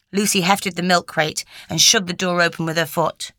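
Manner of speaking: ordinary delivery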